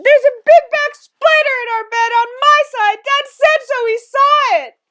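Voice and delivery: high, very loud frantic yell. A narrator's reading. Mother pounds on the bed about the spider, yelling. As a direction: yell it in a squealing panic. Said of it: "pounding on the bed and yelling".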